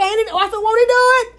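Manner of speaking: high joyful praise. Retold or imitated.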